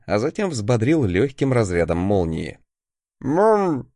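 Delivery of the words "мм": muffled moan through a gag; gagged. Acted. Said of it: "замычал клиент через кляп".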